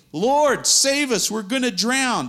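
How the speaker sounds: high, loud, terrified plea; panicked cry for help. Acted. Disciples crying to Jesus in the storm, panicked shout. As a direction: yell it like you're sinking.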